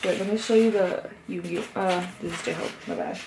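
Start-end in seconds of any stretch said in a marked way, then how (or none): none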